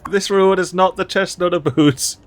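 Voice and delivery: ordinary talk, nothing marked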